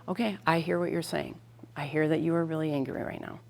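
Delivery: put-on soft, calm acknowledgment to an upset child; soft reassuring parent voice; calm validating. Shown as a demonstration. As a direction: say it gently so they feel heard. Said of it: "this is where the tone, the inflection".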